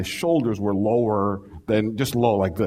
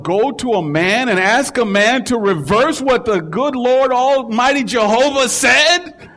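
much louder and higher, slowing on the last words; indignant outburst; 12 semitones higher, 7 dB louder than usual. Pretend. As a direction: thunder it like a preacher outraged at the very idea. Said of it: "I didn't say it like that, Cam".

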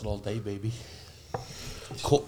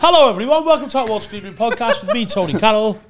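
higher, louder hammed-up show host welcome; podcast host intro; 8 semitones higher, 7 dB louder than usual. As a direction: announce the show like a big radio host. Mock podcast intro announcement.

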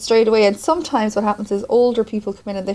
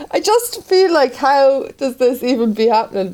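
bewildered high question; 6 semitones higher, slower than usual. Retold or imitated. Retelling bewildered question to doctor.

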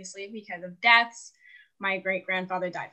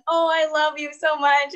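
higher, louder gushing boyfriend impression; mock-gushing I love you; doting boyfriend; 6 semitones higher, 5 dB louder than usual. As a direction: gush it like a smitten boyfriend. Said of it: "he'll be like".

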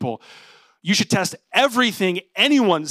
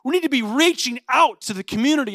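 higher, urgent plea as an eager member; eager church member; 8 semitones higher, 6 dB louder than usual. Acted. Attractional church people urging the pastor to reach out, eagerly. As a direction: voice an enthusiast pressing the case. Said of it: "these people are like".

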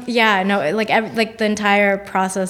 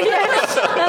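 ordinary talk

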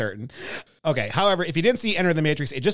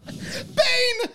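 high yell through laughter; laughing yell. Retold or imitated. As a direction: shout the name while cracking up. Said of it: "Just like".

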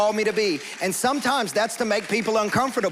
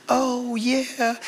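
a light imitation of a gentle older woman, pitched higher; Mama Heidi; 5 semitones higher than usual. Preacher imitates Mama Heidi going round the room, a light higher coo. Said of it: "she speaks kinda like this".

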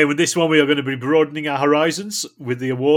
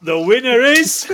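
dramatic announcement; 9 semitones higher, 5 dB louder, slower than usual. Acted. Mock award ceremony suspense.

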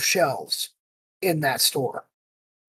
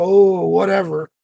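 slow, bored brush-off; dismissive mimic. Store staff mocked as brushing off the angry customer, slow and dismissive. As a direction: wave the complaint away lazily. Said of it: "they just go".

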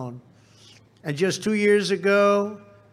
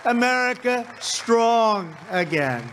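shouted rally climax; 3 semitones higher than usual. Real, not acted. Rally climax, crowd chant lines.

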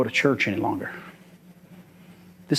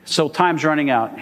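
ordinary delivery

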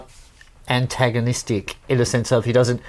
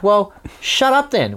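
higher, drawn-out mock exasperation; exasperated retort; 10 semitones higher, 3 dB louder, slower than usual. Musician on stage to a kid with no answer, exasperated. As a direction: slowly tell off a clueless heckler. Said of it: "We're actually on the stage going".

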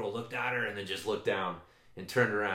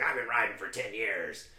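mock disgust. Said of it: "she's all disgusted looking".

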